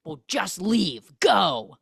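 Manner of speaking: slow start, loud final burst; mock upset dismissal. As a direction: send them away in a huff. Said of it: "he gets all upset".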